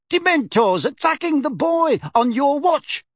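put-on old lady shouting blame; furious shout. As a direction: blast him for deserting his post. Figg scolding Mundungus.

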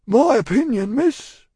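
high, loud character voice; earnest Cockney servant. A narrator's reading. Cruncher giving Miss Pross his opinion, earnest. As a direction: speak up as a plain-spoken servant.

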